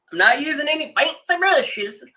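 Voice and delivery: a goofy voice she laughs at herself for; odd put-on voice. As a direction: say it in a silly put-on voice. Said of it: "I don't know why I had to talk like that".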